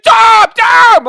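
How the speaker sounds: high, loud repeated shout; reenacted on-field yell; urgent calling out. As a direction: imitate a teammate yelling for the pass. Teammate calls him in retold game.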